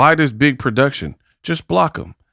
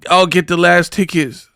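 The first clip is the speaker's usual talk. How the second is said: mocking imitation; 6 semitones higher than usual. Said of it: "and you're like".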